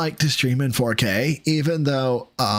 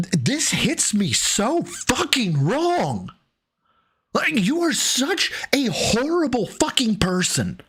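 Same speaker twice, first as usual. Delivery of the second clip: higher, slow indignant disbelief; outraged; 10 semitones higher than usual. Real, not acted. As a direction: let the outrage crack upward. Outraged rant peak.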